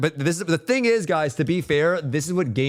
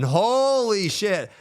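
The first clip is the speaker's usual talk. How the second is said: higher, slow drawn-out amazement; hyped-up gasp; 8 semitones higher, much slower than usual. Real, not acted. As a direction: savor it like a dream roadmap. Hyping his own roadmap idea.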